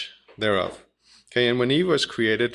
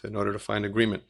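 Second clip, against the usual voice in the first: usual speaking voice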